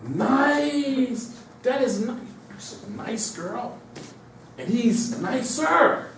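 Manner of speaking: drawn-out, high-pitched "nice"; mock media script. Media telling people to be nice, mock high drawn-out praise. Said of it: "Listen to the scripts".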